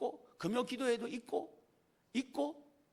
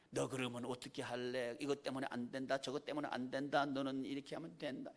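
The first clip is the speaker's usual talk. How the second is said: a touch lower and softer, lecturing; imitated discouraging voice; 7 semitones lower, 6 dB quieter than usual. Preacher quotes discouraging advice in a subdued lecturing tone. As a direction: mildly mimic someone telling you it won't work. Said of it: "이런 말을 너무 많이 듣는 거예요".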